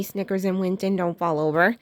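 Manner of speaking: ordinary delivery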